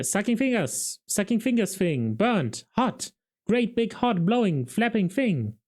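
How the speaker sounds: put-on high, keen young man calling out guesses; bright, excited charade guessing; eager guessing. A narrator's reading. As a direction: rattle off guesses with rising excitement. Carrot guessing, higher.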